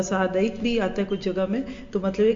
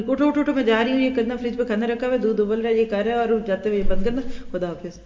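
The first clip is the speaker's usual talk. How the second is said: fast, breathless string of orders; rushed list; faster than usual. Retold or imitated. Mother rushing orders at sleeping son on her way out. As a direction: rattle off chores while leaving. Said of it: "پوری list سنا دیتے ہیں".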